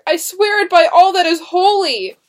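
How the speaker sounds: high, loud, desperate vow; impassioned oath. A narrator's reading. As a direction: swear it out at full voice. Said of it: "William declared".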